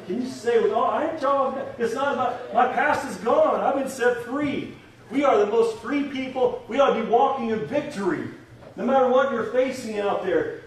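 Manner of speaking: high-pitched, urgent pulpit voice; rally the congregation with rising fervor; fervent preaching. Real, not acted. Preacher telling the church they are victorious, fervent.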